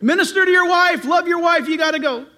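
high, loud insistent urging; hammed-up scripture giving orders; commanding Bible voice. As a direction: nag like an insistent conscience. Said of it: "the Bible is like saying".

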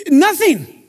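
put-on a touch of a shocked teenager; mock outraged teen. Pastor imitating a teen told no phone, mock outrage. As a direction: play the teen's horror.

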